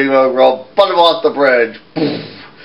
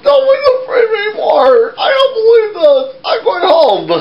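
very high wailing shout; crying shout. Acted. The giant storms home, high crying wail.

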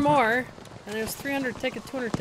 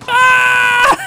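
sudden loud high scream; pained scream; 15 dB louder than usual. Real, not acted. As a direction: cry out as if shot. Hit in firefight.

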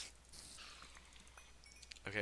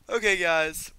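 much higher, louder host call; outro call; 10 semitones higher, 17 dB louder than usual. Real, not acted. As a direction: grab viewers' attention. Starting the outro.

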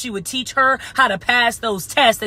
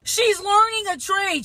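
high-pitched mock excuse; derisive, pitched-up imitation; mocking echo; 7 semitones higher than usual. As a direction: parrot the excuse in a higher voice. Mockingly echoes the commenters' excuse.

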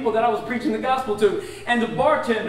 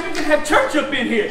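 hyped-up pastor; 6 semitones higher, 6 dB louder than usual. Retold or imitated. Pastor hyping him up.

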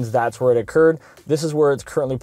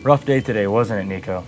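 robot voice; 4 semitones lower than usual. Shown as a demonstration. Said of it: "talk out of it in kind of a robot voice".

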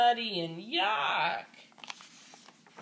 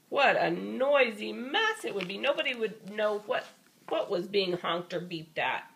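plain reading voice

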